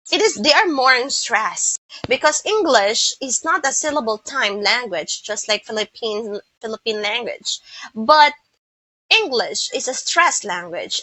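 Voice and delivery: ordinary talk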